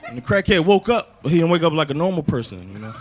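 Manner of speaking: ordinary talk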